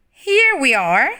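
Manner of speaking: put-on loud bright child voice; loud happy little girl; excited arrival. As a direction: arrive with a cheerful shout. Bonnie arrives in backyard.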